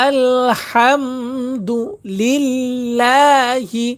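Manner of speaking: আলহামদুলিল্লাহি with the লিল্লাহ drawn out long and high; long lillah. Shown as a demonstration. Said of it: "এই যে লিল্লাহ টান হবে".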